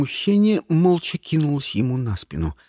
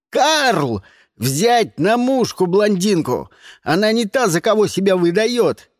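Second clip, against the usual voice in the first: loud, higher commanding voice; shouted orders; 5 semitones higher, 5 dB louder than usual. A narrator's reading. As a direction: command your man urgently. Lars barks orders at gunpoint. (громко командует, повысив голос)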